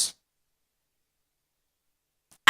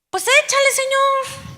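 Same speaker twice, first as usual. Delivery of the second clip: imitating an eager believer, much higher; mock eagerness, pitched way up, asking to be blessed; ansia fingida; 10 semitones higher than usual. Creyente imitado pide bendición ansioso. (imitación burlona de un creyente ansioso, con voz muy aguda)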